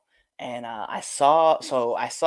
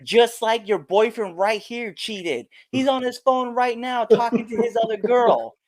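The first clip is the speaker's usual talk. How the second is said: taunting accusation; 9 semitones higher, 4 dB louder than usual. Retold or imitated. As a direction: call him out in a raised pitch. Wrestler taunting heckler's boyfriend.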